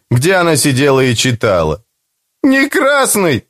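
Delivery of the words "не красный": high, slow tearful cry; a boy sobbing out a denial; mild sobbing boy; tearful wail. A narrator's reading. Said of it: "ревел я, захлебываясь горькими слезами".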